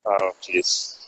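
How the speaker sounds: muffled, distant phone line; quiet and far off on the call; bad connection. Real, not acted. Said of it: "you cut out again".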